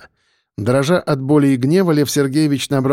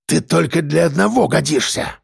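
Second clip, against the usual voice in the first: hissing mayor; 2 semitones higher than usual. Acted. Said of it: "шипел он".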